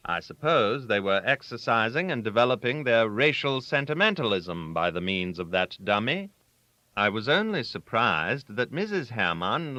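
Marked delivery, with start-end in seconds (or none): none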